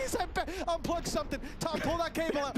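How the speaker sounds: high, urgent begging; excited pleading. Real, not acted. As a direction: beg them to stop it. Begs to stop replay.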